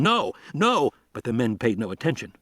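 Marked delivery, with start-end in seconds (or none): 0.0-0.9 high, desperate shouts; shouting. A narrator's reading. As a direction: yell it to stop them.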